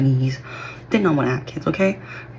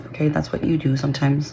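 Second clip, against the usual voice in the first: ordinary talk, nothing marked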